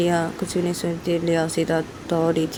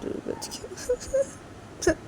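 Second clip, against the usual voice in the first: sob; 5 dB quieter, much slower than usual. Acted. Ruhi hears mother's voice.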